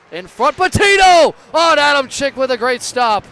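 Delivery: yelling the save, big and high; rising play-by-play shout; excited shout. Real, not acted. Goal-line scramble call.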